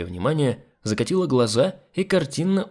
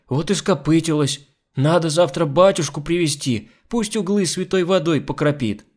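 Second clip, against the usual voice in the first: high-voiced, folksy frightened maid; superstitious maid; 6 semitones higher, 3 dB louder than usual. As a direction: recount the scare in a raised voice. Frightened maid tells of the devil. (испуганная простоватая домработница)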